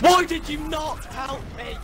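annoyed shout. Real, not acted. Angry at teammate.

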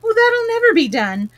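high, loud despairing cry; Alice giving up on the endless toast, despairing and high. A narrator's reading. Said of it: "in despair".

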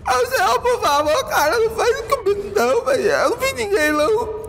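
high, whimpering plea for mercy; crying beggar. Acted. Scared bystander begs the player, high fake crying. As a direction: sob out the plea like a terrified bystander. Voicing a begging, crying NPC.

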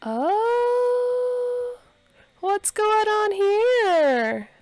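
high, slow, drawn-out ooh; nosy singsong, stretched way out; teasing intrigue. Real, not acted. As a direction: tease them for being caught flirting. Teasing Sims caught flirting.

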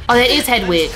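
normal talking voice

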